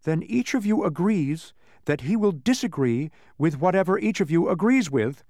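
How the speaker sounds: high-voiced triumphant reasoning. A narrator's reading. Milo trapping the mathematician with logic, triumphant. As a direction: press the point home in triumph. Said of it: "said Milo triumphantly".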